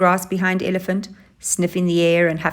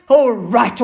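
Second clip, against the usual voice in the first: sharp, louder snap at the elephant; snapped; 5 semitones higher, 7 dB louder than usual. Acted. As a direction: cut him off, irritated. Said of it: "snapped Hyena".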